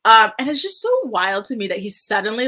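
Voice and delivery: ordinary delivery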